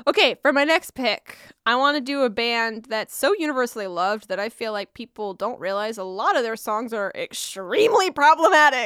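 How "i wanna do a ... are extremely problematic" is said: ordinary talk, nothing marked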